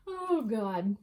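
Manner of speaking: ordinary talk, nothing marked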